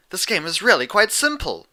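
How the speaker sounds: put-on prim, precise young mare; Twilight's voice. A narrator's reading.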